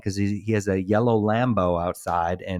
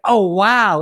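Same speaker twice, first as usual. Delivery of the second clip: higher and louder, mock wide-eyed wonder; mock surprise; 8 semitones higher, 7 dB louder than usual. Brother mock-marvels at the baggie of drugs. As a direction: gasp it out like a fake discovery. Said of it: "He's like".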